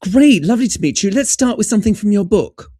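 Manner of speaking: high, chirpy British greeting; British accent. Retold or imitated. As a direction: put on a loud, high-pitched British politeness. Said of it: "the British team were like".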